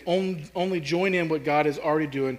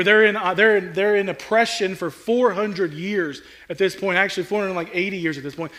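ordinary talk, nothing marked

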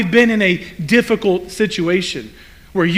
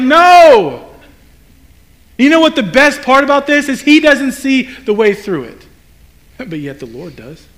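usual speaking voice

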